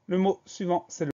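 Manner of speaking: ordinary talk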